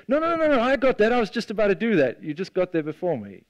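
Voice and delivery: high, quick mock-eager no-no-no; mock eager protest. Disciple protesting to Jesus, mock eager. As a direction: pretend you were about to do it anyway.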